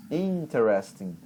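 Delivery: "Interesting" drawn out on IN, falling tail; stress on first syllable. Shown as a demonstration. Teacher repeating the model for the student, push at the start. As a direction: give gas to IN in "Interesting", then let it flow. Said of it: "dá o gás lá no início".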